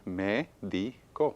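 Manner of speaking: "Médico" very slowly as mé, di, co; syllable by syllable. Shown as a demonstration. Teacher confirming the syllable count, syllable by syllable. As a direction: break "Médico" into three spaced beats. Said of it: "¿Cuántas sílabas?".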